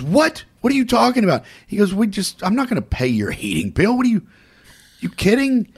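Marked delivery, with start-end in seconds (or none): 0.0-1.4 much higher, louder retold outburst; indignant disbelief. Woman told her heating bill won't be paid, indignant disbelief. 1.9-5.7 high-pitched acted scolding; retold exasperated refusal. Mike refusing a woman's monthly bill, exasperated refusal.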